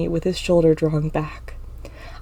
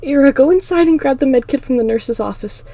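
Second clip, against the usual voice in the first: raised, higher urgent voice; urgent order; 7 semitones higher, 8 dB louder than usual. Acted. Star sends Ira for the med kit, urgent order. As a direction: call out an urgent instruction.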